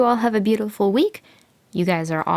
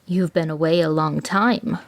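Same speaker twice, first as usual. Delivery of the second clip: usual speaking voice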